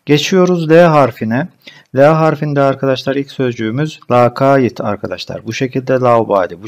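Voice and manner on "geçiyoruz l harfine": ordinary talk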